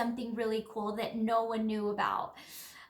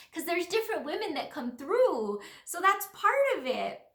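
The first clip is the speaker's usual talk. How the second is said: ordinary talk, nothing marked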